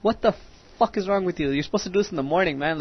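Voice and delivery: incredulous scolding. Retold or imitated. Retold scolding of shaving classmate.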